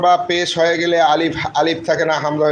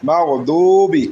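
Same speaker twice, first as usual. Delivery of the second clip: "দুবি" with the long u held one beat; one-alif stretch; much slower than usual. Shown as a demonstration. Teacher showing the madd, "দু" drawn out in "দুবি". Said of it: "এখানে এক আলিফ টান".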